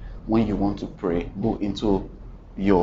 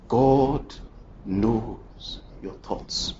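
very slow, soft; slow hush; 5 dB quieter, much slower than usual. Real, not acted. Preacher letting the point sink in, very slow and soft. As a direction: let each word sink in.

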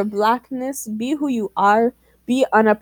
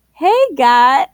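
code-switch voice; 3 semitones higher, 5 dB louder than usual. Retold or imitated. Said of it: "I'm talking like".

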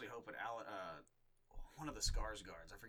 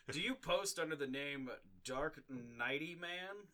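Chris Hansen impression; 5 semitones higher, 3 dB louder, slower than usual. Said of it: "Chris Hansen goes out".

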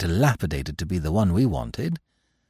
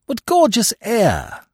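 high, slow delighted exclamation; exclaiming; 12 semitones higher, slower than usual. Acted. Hastings to Poirot on arriving, slow and high with delight. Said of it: "I exclaimed".

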